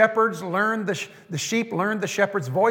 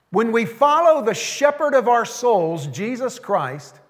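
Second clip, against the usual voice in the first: usual speaking voice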